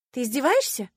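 high, loud outraged question; indignant. A narrator's reading. Heroine facing another refusal from Marcus, indignant. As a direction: challenge him in indignation. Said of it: "возмутилась я".

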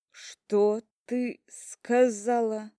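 slow words through gritted teeth. A narrator's reading. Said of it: "сквозь стиснутые зубы".

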